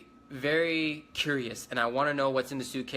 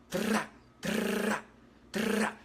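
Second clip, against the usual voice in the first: a quick burst of mouthed gunshots, pitched higher; mock gunshot noises, three short bursts; imitated gunfire; 7 semitones higher, much slower than usual. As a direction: spit out the shots like suppressed rifle bursts. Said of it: "They were like".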